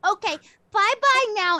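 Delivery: put-on high, loud old-lady goodbye; shooing farewell. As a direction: shoo them out with a singsong farewell. Old lady shoos visitors out.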